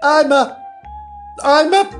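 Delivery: high, halting attempts to answer; flustered faltering. A narrator's reading. Alice struggles to say what she is, flustered. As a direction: start high and break off, lost for words.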